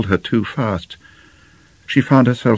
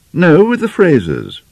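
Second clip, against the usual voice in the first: high, louder curt answer from a daughter; sullen correction; 11 semitones higher, 5 dB louder than usual. Acted. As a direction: correct your mother, raised pitch falling away. Bitter daughter corrects mother.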